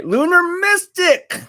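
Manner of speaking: high, drawn-out shout-out; slow, high-pitched hail to a viewer; calling out a name. Real, not acted. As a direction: stretch the name out high, like hailing someone.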